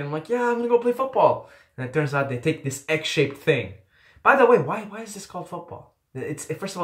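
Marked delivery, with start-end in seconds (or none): 0.3-1.5 high, fast and eager; a kid's high, quick rush of excitement; excited. Retold or imitated. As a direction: burst out, thrilled to play. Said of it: "so excited".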